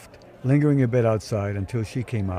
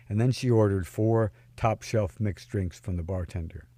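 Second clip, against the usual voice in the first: ordinary delivery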